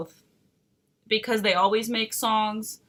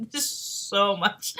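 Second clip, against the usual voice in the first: laughing; 5 semitones higher, slower than usual. Real, not acted. Laughing at the Oedipus idea.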